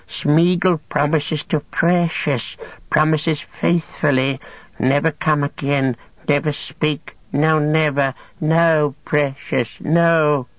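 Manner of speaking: high, slow creature voice, frantic promises; Gollum, swearing eagerly. Acted. Captive swearing on the Precious, high desperate whine.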